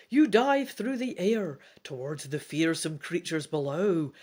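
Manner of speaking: animated read-aloud storytelling; story narration. A narrator's reading. Reads gamebook narration aloud.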